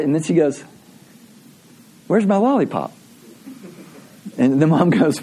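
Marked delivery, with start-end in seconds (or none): 2.1-2.9 lightly voiced child asking; raised pitch, imitating an expectant kid; little girl's demand. Little girl asks mom for her prize, lightly demanding.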